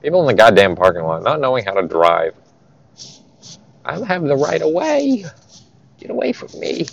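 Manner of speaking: grumbled complaint turning high and soft; real road rage. Real, not acted. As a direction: gripe under your breath at other drivers. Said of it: "some actual road rage here".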